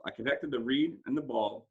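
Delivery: usual speaking voice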